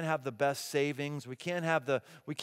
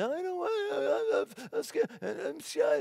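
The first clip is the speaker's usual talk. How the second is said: put-on high, drawn-out little-kid whine; mock scared kid, pitched way up; terrified child; 14 semitones higher, slower than usual. Daughter balking at fetching the menus, mock terrified. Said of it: "she would be terrified".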